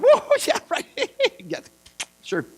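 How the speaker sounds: high, scoffing laugh through the words; mocking laughter. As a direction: laugh him off in disbelief. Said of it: "the Bible says that Sarah laughed".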